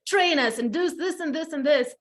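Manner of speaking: mock-eager group of young interns; lightly voiced eager trainees. Intern agents' eager plea, voiced.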